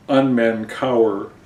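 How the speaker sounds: ordinary talk, nothing marked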